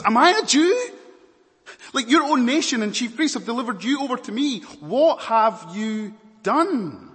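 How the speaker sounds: high, loud, indignant Pilate; Pilate's retort. Acted. Pilate retorting to Jesus, high and indignant. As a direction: fire it back defensively. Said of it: "he retorts to Jesus".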